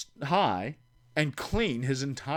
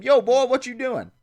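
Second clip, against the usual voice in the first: higher, louder, buddy-style drawled greeting; imitating Terry; 12 semitones higher, 10 dB louder than usual. Imitating Terry calling his friend at three AM, higher and louder. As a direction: sing out the late-night call like the friend. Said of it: "My phone would ring at six A M".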